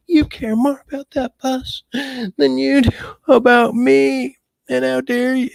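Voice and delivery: high, shrill-pitched mock accusation; hammed-up jealous guilt trip; mimicking a jealous girlfriend. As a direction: squeak it out like a wounded, clingy partner. Retelling girlfriend's jealous outburst.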